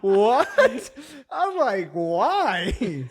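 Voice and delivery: high, loud, drawn-out disbelief through laughter; hammed-up, laughing outburst of disbelief; incredulous protest. Acting his protest to mom.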